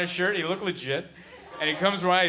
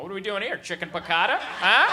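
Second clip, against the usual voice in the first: nosy, rising questions, climbing to "Huh"; falsely breezy dinner chat; mock chummy small talk; 4 semitones higher than usual. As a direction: act casual, pitching each question higher. Acted breezy small talk after bombing.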